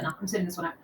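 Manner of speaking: low and soft refusal; quiet. Acted. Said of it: "Val's like".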